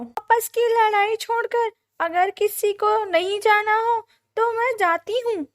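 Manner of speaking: high, loud, insistent bird voice; protesting bird wife. A narrator's reading. Bird wife telling crow husband to go, protesting. Birds argue over who goes.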